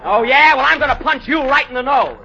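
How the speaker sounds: loud, high challenge; blustering comeback; angry threat. Acted. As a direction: square up for a fight. Actor threatens the shouter.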